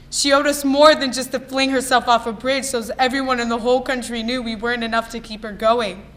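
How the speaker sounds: loud, hurt accusation; anguished angry outburst. Acted. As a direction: raise your voice sharply, blaming someone you lost. Monologue outburst at dead mother.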